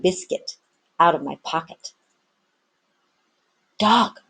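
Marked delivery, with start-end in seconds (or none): none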